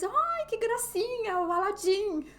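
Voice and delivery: high, loud gushing coo; cooing delight. Retold or imitated. Retelling her gushing at the cute Aladdin cover, high and loud. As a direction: gush over the cute tire cover. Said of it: "eu falei assim em português".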